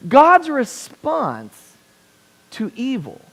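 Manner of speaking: drawn-out, weighty opening words; slow emphasis. Acted. Preacher stresses God's answer to evil, very slowly. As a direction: lean hard on each word, slowly.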